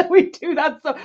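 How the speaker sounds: high laugh then words still laughing. Real, not acted. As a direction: laugh while admitting it. Laughing about team's dumb ideas.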